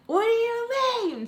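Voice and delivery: high, loud indignant yell; retold yelling. Daughter yelling back at mom, retold indignant yell. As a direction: shoot the pitch way up, re-enacting a yell. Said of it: "So I start yelling at her".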